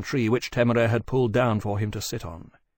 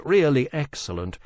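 usual speaking voice

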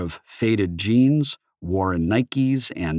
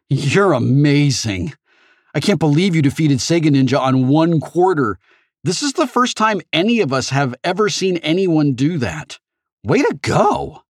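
put-on higher, eager awkward boy voice; nervous teen boy; 7 semitones higher, 5 dB louder than usual. A narrator's reading. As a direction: gush at her shyly. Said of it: "Ogg awkwardly held up his right hand".

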